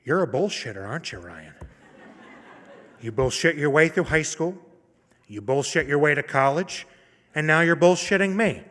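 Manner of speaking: building from level to a loud mock scold; scolding professor. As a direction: play the tough professor calling him out. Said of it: "she, in turn, took a dramatic pause".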